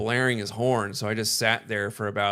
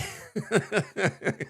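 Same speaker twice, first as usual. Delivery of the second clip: high laugh; 9 semitones higher than usual. Real, not acted. Laughs at own joke.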